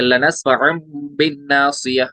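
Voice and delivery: लनस्फअन बिन said slowly, the N turned to a held M; iqlab with ghunna. Shown as a demonstration. Teacher showing the changed noon, लनस्फअन ending in a hummed M. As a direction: hum a long M between लनस्फअन and बिन. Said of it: "ये नून साकिन हमने मीम में change कर दिया".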